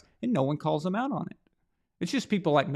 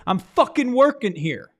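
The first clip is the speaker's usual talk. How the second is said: slow, loud, higher irritated snap; angry retort; 6 semitones higher, 6 dB louder than usual. Retold or imitated. Coach snaps back at a fan, slow loud irritated retort. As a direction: bite back at a pestering fan, mock annoyed. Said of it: "looks at him and says".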